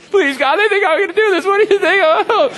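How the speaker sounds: high-pitched, loud frantic begging prayer; comic high-voiced panic; panicked mock plea. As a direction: squeal a desperate prayer, hammed up for laughs. Acts out panicked private prayer.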